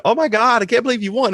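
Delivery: high, breathless gushing over the win; gushing disbelief. Retold or imitated. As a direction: gush like a thrilled fan. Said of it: "It was".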